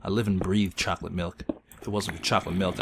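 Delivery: normal talking voice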